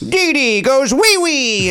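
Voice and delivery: high, drawn-out home-run catchphrase; John Sterling. Acted. Yankees announcer celebrating a homer, hammed-up impression. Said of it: "what, uh, John Sterling would say".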